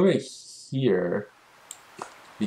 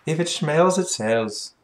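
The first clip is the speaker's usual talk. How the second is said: much higher, louder mock shopkeeper singsong; hammed-up motto; 7 semitones higher, 4 dB louder, slower than usual. Streamer mocks the shopkeeper's old papa's saying, hammed-up higher voice. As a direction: repeat the saying as a hammed-up joke. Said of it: "That's what my old papa used to say".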